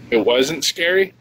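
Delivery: ordinary delivery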